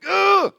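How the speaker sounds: a shrill, very high, loud outburst of panic; panicked child's cry. Retold or imitated. Speaker voicing his inner child in pain, panicked cry. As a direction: yelp like a terrified child. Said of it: "the child thinks crazy things".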